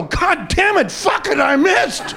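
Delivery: booming high yell from the sky; God's voice cursing. Acted. God shouting down from the sky after missing the golfer. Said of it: "hears a voice go".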